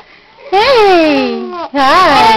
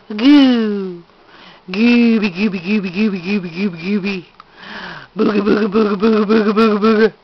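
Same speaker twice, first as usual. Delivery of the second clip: low, playful nonsense chant; baby talk; 6 semitones lower than usual. Acted.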